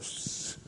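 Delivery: sharp voiceless shush. Retold or imitated. Scared coworker shushing the speaker in the bank, voiceless shush. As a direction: hush someone fast. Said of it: "He's like".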